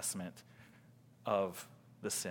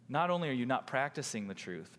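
normal talking voice

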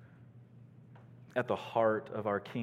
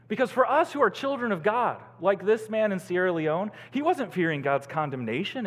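raised, louder preacher's voice; forceful preaching; 9 semitones higher, 6 dB louder than usual. Real, not acted. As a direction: proclaim it urgently to the congregation.

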